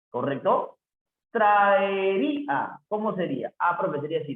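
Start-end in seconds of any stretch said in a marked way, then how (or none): 1.3-2.8 "Traería" drawn out, the Í held high; stress on Í. Shown as a demonstration. Teacher finding the mayor fuerza de voz, "Traería" slow with stressed Í. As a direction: stretch "Traería", leaning on RÍ. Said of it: "la mayor fuerza de voz".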